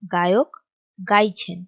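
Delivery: two words set apart by a pause; measured word-by-word delivery; slow dictation. Shown as a demonstration. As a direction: dictate it while writing. Dictates example while writing it.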